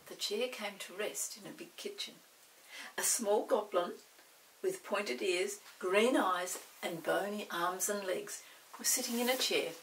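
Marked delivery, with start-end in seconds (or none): none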